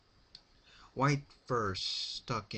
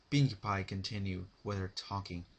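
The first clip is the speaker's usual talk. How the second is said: ordinary delivery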